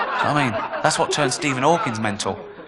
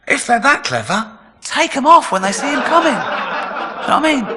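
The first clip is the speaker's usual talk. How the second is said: mock incredulous; 11 semitones higher than usual. Mocking victims' logic.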